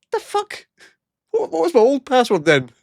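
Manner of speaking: high, baffled outburst; incredulous. Real, not acted. [name] baffled by the password reset, high disbelieving outburst.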